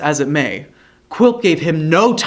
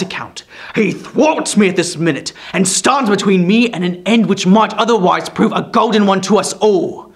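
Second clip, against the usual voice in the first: loud, raised driving anger; insistent dwarf; 5 semitones higher, 5 dB louder than usual. A narrator's reading. Quilp demanding to be heard, angry. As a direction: press your grudge hard.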